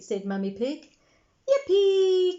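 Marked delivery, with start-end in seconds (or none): 1.5-2.4 high, loud joyful yippee; cheering. A narrator's reading. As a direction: squeal it out with delight. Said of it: "cheered Peppa".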